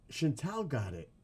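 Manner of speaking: usual speaking voice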